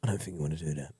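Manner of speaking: low, quick gangster warning; menacing fixer voice. Retold or imitated. Fixer warning Uncle Phil's guests, low and menacing. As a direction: mutter it like a London heavy, low and fast. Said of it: "Jimmy comes".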